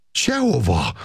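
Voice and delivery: acted cry of flat-out refusal. A narrator's reading. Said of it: "kiáltotta a lány".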